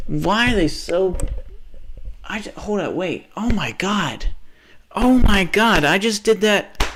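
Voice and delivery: high and loud, alarmed; oh-no shriek of realization; shocked panic. Real, not acted. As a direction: panic as it dawns on you. Realizes mistake.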